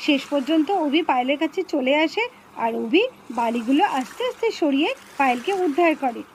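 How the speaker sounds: low, easy narrator voice telling viewers the story; story narration. A narrator's reading. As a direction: narrate to the friends watching. (দর্শকদের কাছে গল্প বলা)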